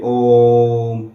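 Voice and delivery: ओम intoned slowly with the ओ stretched; teacher chanting ओम to show pluta length; drawn-out Om. Shown as a demonstration. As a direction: let ओम ring out slowly. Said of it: "बोलने में तो बोल दिए".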